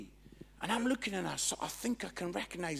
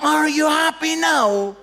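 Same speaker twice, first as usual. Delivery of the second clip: slow, high, very loud question; outraged disbelief; 10 semitones higher, 17 dB louder, slower than usual. Retold or imitated. Speaker echoes the guy's reply, loud outraged disbelief. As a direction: belt out each word in disbelief.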